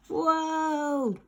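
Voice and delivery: a long high whoa sliding down; whoa cry. Acted. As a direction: cry out as you slip. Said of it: "he cried".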